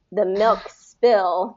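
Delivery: "milk spill" slowly linked as one word. Shown as a demonstration. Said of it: "link it".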